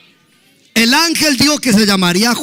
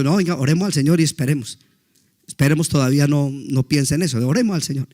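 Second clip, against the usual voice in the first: soft reassurance; 6 semitones lower, 6 dB quieter, faster than usual. Retold or imitated. Comforting the crying sister.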